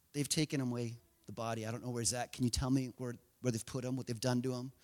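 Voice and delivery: hushed, low pleading; understated grief, very quiet; broken, lost. Acted. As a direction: plead softly, as if worn out by grief. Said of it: "She's broken. She's weeping".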